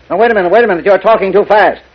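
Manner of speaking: agitated interruption. Acted. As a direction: cut in on a caller who is rushing. Agitated mayor interrupts caller on phone.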